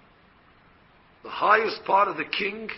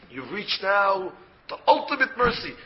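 normal talking voice

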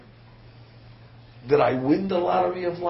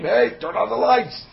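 mock yell; 6 semitones higher, 6 dB louder than usual. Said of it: "so he starts to scream".

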